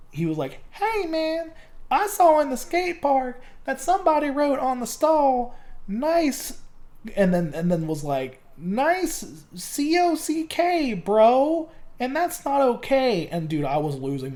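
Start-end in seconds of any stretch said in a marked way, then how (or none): 0.7-6.6 imitated kid in a high falsetto; high-pitched kid voice. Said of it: "the same kid came up to me". 8.6-13.3 high kid impression, slowly spelling it out; high-pitched kid voice. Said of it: "the same kid came up to me".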